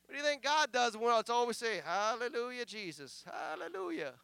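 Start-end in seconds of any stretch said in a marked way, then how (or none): 1.8-4.1 mock rote praise, soft with a lifted last word; parroted praise by habit; vain repetition. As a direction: imitate empty prayer. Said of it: "it's called vain repetitions".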